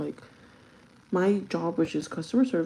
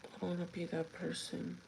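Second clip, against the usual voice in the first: soft repeat; 14 dB quieter than usual. Real, not acted. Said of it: "repeated much softer".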